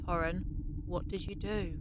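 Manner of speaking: officer confronting her captain quietly; stunned quiet question. Acted. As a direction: breathe it in disbelief. Salome realising the betrayal.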